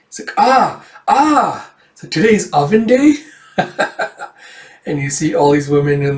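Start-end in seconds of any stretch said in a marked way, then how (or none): 0.4-1.7 two high, loud cries of sudden realisation; startled realisation. Acted. Character realising it is oven day, startled cry. 2.1-3.1 high, loud, startled question; surprised question. Acted. Forgetful character realising what day it is, surprised. 3.6-4.3 short chuckle after acting a line; amused chuckle. Real, not acted. Reader amused by the forgetful character, quick chuckle.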